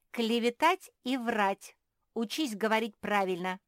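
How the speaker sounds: loud, high, emphatic correction; scolding correction. A narrator's reading. Marie corrects Phil's made-up words, scolding. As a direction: drive the correct words home reprovingly. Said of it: "не упустила возможности сделать очередное замечание".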